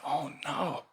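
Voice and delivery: soft breathy oh no; dismayed whisper. Acted. Monk hushed in dismay at the villagers' news. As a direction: let the worry slip out under the breath.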